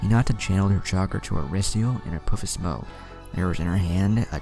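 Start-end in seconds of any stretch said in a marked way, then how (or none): none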